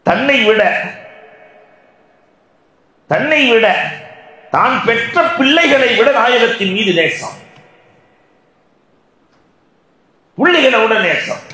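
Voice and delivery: high declamation with long dramatic pauses; preaching. Real, not acted. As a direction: let each repeated phrase ring out. Preacher builds climax by repetition.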